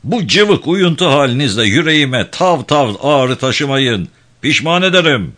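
high, loud overseer's tirade; arrogant, threatening. A narrator's reading. Kosor threatens his idle diggers, arrogant and angry. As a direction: threaten the crew like a haughty boss. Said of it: "kibirli Dobran sesi".

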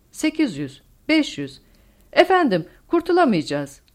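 high, loud calls with mounting alarm; alarmed countdown. A narrator's reading. Co-pilot calling altitude in a diving plane, alarmed shout. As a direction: call out as if about to crash.

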